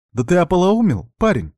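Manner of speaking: high, loud angry snap; angry outburst. Acted. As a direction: flare up at him. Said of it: "взвилась Марьяна".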